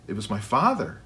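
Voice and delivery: plain reading voice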